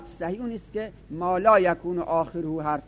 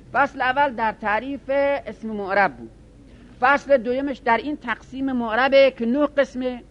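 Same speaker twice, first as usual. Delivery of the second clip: raised, louder and higher, pressing each point; emphatic listing; 6 semitones higher, 7 dB louder than usual. Real, not acted. Teacher stressing the chapter outline to students, loud and high. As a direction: announce the section headings forcefully.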